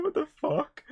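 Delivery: exaggerated incredulous cry; mock disbelief. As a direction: play the baffled viewer. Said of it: "It's like".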